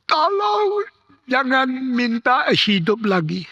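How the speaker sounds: high, slow, thin little cry; tiny-voiced cry. Dying man begging not to live on, tiny-voiced cry. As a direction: cry out weakly in a very small voice. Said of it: "dengan suara yang sangat kecil berteriak".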